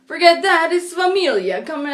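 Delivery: Jersey attitude. Retold or imitated. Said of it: "and they're like".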